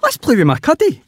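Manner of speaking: high squeaky little pig; bright childlike piggy voice; Peppa Pig voice. A narrator's reading.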